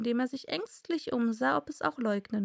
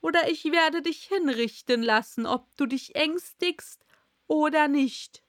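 annoyed king, higher and threatening; ärgerlich; 5 semitones higher, 4 dB louder than usual. A narrator's reading. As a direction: threaten him in royal irritation. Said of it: "wiederholte der König ärgerlich". (ärgerlich drohender König)

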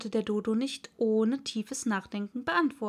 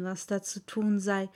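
usual speaking voice

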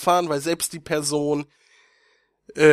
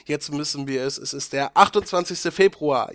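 usual speaking voice

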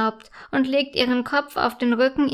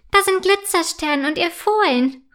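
higher-pitched, full of joy; joyful call; 6 semitones higher, 3 dB louder than usual. A narrator's reading. Unicorn spots her friend with the foal, joyful call. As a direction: call out happily to the others. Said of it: "ruft sie voller Freude".

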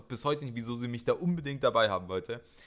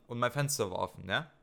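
usual speaking voice

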